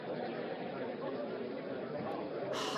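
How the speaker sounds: long, soft exhausted sigh. Real, not acted. Player resigned to a bad umpiring decision, long sigh. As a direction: let the air out slowly in defeat.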